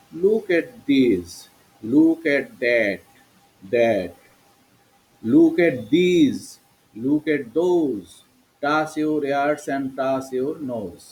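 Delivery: very slow, word-by-word classroom chant; drawn out for pupils to repeat; listen-and-say model. Shown as a demonstration. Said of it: "listen and say". (শেখানোর জন্য খুব ধীরে টেনে টেনে)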